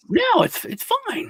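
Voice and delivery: very high-pitched mock reassurance; eager dismissal. Scammer reassuring a would-be grant winner, eagerly dismissive. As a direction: squeak out an overeager brush-off.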